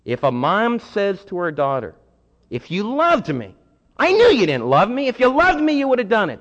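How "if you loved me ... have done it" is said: higher, louder wounded mother pleading; guilt-tripping mom. Retold or imitated. A mom manipulating her daughter, wounded and pleading. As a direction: lay the guilt on thick as a hurt mom.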